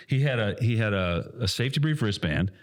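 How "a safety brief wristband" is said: ordinary delivery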